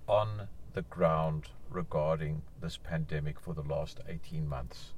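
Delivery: usual speaking voice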